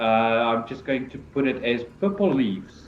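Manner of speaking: ordinary delivery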